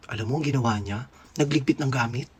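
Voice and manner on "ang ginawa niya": ordinary delivery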